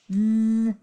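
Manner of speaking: chainsaw buzz with tongue between teeth; voiced th demo. Shown as a demonstration. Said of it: "with your voice turned on".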